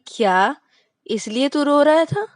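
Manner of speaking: can't believe that's why; surprised disbelief. Acted.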